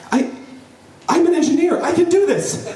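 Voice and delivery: very high-pitched mock-panicked squeal; panicked self-reassurance. Engineer psyching himself up over the diaper, high mock panic. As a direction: talk yourself up in a hammed-up squeaky panic.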